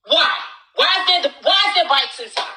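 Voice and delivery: sharp, high why; shouted disbelief at the bikes; exasperated yell. Real, not acted. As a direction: call it out in outrage. Yelling about bikes indoors.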